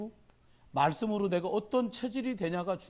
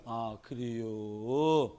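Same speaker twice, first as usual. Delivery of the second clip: very slow, noncommittal Chungcheong-style answer; slow Chungcheong drawl; 7 semitones lower, much slower than usual. Retold or imitated. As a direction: stretch out the reply like a cautious Chungcheong local. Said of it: "이렇게". (아주 느릿하게 눈치 보는 충청도식 대답)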